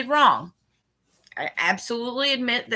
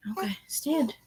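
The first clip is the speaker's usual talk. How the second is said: whispered coaxing; 6 dB quieter than usual. Real, not acted. Coaxing her toddler to stand.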